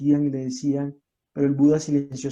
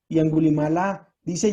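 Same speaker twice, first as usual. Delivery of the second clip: ordinary delivery, nothing marked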